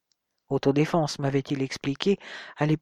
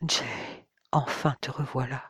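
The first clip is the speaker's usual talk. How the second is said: soft and tender, voice lowered; gentle; 5 dB quieter than usual. A narrator's reading. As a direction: say his name softly, lovingly. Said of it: "prononcer mon nom avec douceur".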